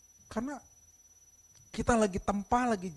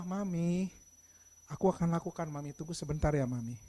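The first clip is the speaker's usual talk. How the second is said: low, gentle answer to mum; calm, obliging child; polite child's reply; 5 semitones lower than usual. Shown as a demonstration. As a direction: answer mother respectfully, voice lowered. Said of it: "tapi beda intonasinya".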